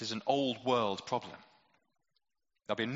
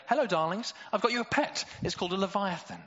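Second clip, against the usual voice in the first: much higher, louder mock-cheerful dad; cheery parent voice; 8 semitones higher, 4 dB louder than usual. Parent bringing home Leviathan to the kids, in a high mock-cheerful voice. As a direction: sing it out like proud pet news. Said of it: "take him home to your kid and say".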